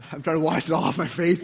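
usual speaking voice

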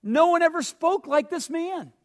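high, loud, mildly hammed-up astonishment; amazed report. Temple officers explain returning without Jesus, amazed and high-pitched.

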